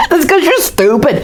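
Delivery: mock him cruelly; taunting. Kids taunt Morgan, high and sneering.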